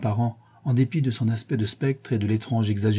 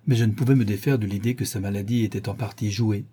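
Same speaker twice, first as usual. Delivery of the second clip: plain reading voice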